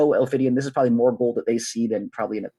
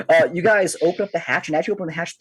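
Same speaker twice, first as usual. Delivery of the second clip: usual speaking voice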